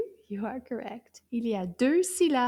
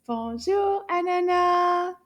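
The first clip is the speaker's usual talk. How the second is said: loud, high sing-out call; nice loud voice; 5 semitones higher, 6 dB louder, slower than usual. Shown as a demonstration. Teacher calling Anana to join class, loud and drawn-out. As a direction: call it out long and clear. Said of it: "in a nice loud voice".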